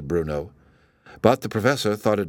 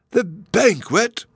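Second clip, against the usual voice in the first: very high, slow, eager exclamation; an old man leaping at the word banquet; high and slow with delight; excited cry; 12 semitones higher, 4 dB louder, much slower than usual. A narrator's reading. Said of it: "cried the other professor".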